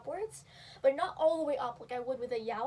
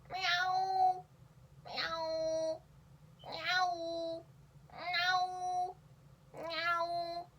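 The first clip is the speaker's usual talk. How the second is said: high drawn meowl, M not Y; 5 semitones higher, much slower than usual. Shown as a demonstration. Teaching the meowl, high drawn-out cat voice. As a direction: meowl like a yowl with an M. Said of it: "So now we're gonna do the meowl".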